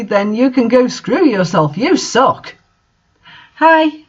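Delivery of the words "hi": bat your eyelashes on the hi; coy flirt, pitched way up; flirty hello. Acted. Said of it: "I said flirtily".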